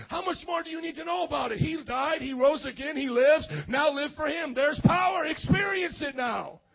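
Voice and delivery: rousing revival preacher; full-force preaching. Real, not acted. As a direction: preach it hard, phrase by phrase.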